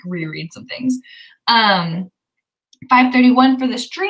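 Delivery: usual speaking voice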